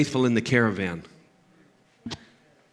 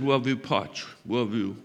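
softly imitated tearful little voice; whimpering child imitation; 3 semitones lower, 5 dB quieter than usual. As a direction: lightly mimic a sick child's small, low whimper. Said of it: "she's whimpering and crying".

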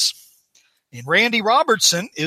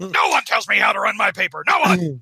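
emphatic, blustering boss; J. Jonah Jameson; 2 semitones higher than usual. Acted. Jameson defends how he runs his paper, acted bluster. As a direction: lay down the law in hammed-up indignation. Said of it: "That's a good Jameson".

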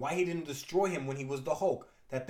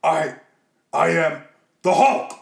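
loud, slow monster roar; Hulk voice; 13 dB louder, slower than usual. Acted. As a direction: bellow it like a brute. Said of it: "The Hulk ... gives it a shot regardless".

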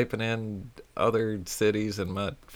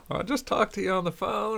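pitched-up indignant protest; high mock insistence; 12 semitones higher than usual. Caller insisting to the trucker, high mock protest. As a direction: play the confused caller, squeaky. Imagined lady reply.

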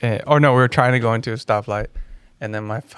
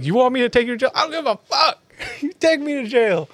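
high-pitched indignant retold yell; yelling at cops; 13 semitones higher than usual. Son mimics dad defying police, high-pitched yelling. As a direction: yell defiantly in a pushed-up high voice. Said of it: "He'll yell at cops".

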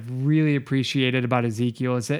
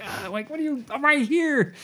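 high-pitched comic complaint; playful high outcry; mock protest at yelling; 11 semitones higher than usual. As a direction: act out being yelled at, voice shooting up. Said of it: "kinda yelling in his ear thing".

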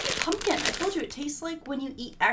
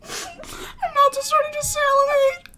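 gagging whine; 9 dB louder than usual. Real, not acted. Gagging after tasting candy corn.

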